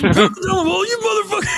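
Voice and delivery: high strained mock shout; hammed-up cursing, pitch way up; mock enraged outburst. Acting out an enraged man.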